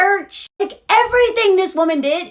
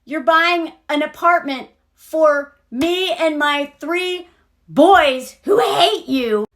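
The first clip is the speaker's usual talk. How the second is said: ordinary talk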